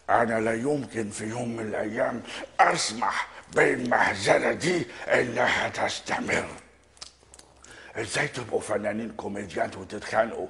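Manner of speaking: deep, soft, grave imitated star voice; Gamil Ratib impression. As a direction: diep en zacht, ernstig als een oude acteur. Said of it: "يا أستاذنا جميل يا راتب".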